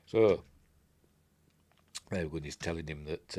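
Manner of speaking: normal talking voice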